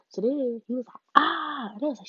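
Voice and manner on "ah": high, loud mock cry of surprise; imitated yelp. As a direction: squeal it like the guy did.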